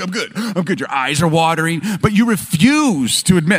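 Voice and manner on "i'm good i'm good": laughing through a cough. Retold or imitated. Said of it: "you're like".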